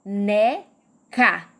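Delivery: "Boneca" slowly split as bo, ne, ca; three spaced beats in "Boneca"; syllable by syllable. Shown as a demonstration. Teacher leading viewers at home, "Boneca" said in separated syllables. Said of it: "Três sílabas".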